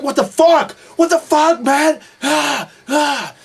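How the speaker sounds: high, very loud enraged yelling; full-volume imitation of a furious rant; furious shouting. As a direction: roar it like an enraged wrestler. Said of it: "he was just furious about everything".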